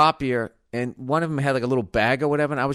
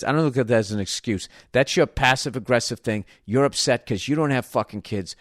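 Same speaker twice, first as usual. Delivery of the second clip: ordinary delivery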